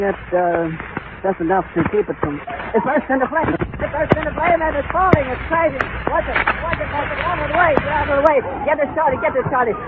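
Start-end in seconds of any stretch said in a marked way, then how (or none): 2.8-5.8 real panic as the airship catches fire; real horrified outcry. Real, not acted. Airship suddenly bursts into flames. 6.1-9.8 high, frantic shouted warnings; real urgent shouting. Real, not acted. Shouting warnings at crowd and cameraman.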